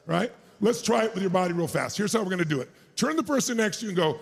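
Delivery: usual speaking voice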